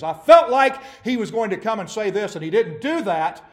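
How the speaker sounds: high, loud, furious tirade; raging complaint. Retold or imitated. Naaman storming off from Elisha's servant, raging. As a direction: rant as if insulted. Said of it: "went off in a rage".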